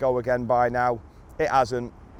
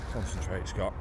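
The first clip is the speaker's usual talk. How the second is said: quiet; 6 semitones lower, 10 dB quieter than usual. Real, not acted. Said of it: "I'm gonna shut up".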